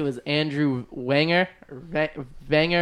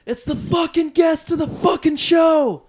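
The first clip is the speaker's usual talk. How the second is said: retold angry shout; 12 semitones higher than usual. Yelling back at the producer, reenacted as a furious shout. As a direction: blow up at him all over again. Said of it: "And I just flipped out".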